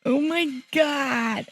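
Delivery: high, slow and hushed; overwhelmed by the music; awed. Real, not acted. Fan awed by the trailer music, high and slow.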